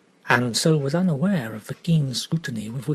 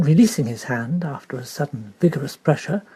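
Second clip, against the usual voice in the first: plain reading voice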